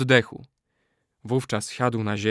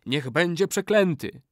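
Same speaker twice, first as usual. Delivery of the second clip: high, falling pronouncement; incanted curse; 6 semitones higher than usual. A narrator's reading. Old man curses any betrayer, incanting. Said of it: "zawołał stary murzyn".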